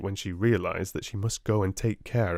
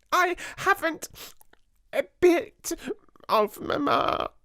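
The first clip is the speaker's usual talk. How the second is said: wailing proclamation. A narrator's reading. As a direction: announce it through tears. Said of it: "she proclaimed".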